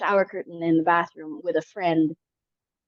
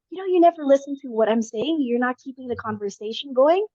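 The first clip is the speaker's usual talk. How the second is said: retold yell; 6 semitones higher, 3 dB louder than usual. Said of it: "He yells at me and says".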